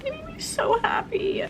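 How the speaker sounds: high breathy whisper. Real, not acted. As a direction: whisper it happily. Whispering about sheets.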